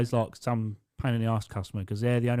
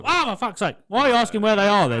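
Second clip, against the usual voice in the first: higher and louder, fed-up snap at the customer; exasperated outburst; 7 semitones higher, 7 dB louder than usual. Retold or imitated. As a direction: let the frustration burst out loud. Exasperated retort to caller.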